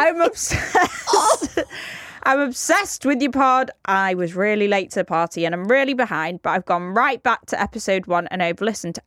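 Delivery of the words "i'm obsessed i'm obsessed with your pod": gushing fan. Retold or imitated. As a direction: stretch out I'm obsessed like a starstruck fan. Reading gushing fan note.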